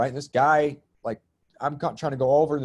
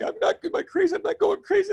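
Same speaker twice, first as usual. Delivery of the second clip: high laughing mock panic, much higher. To a podcast host, mocking her own ascension panic, high and laughing. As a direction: squeak it out through laughter like you're losing it. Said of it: "sometimes you're like".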